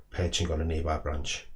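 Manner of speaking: ordinary delivery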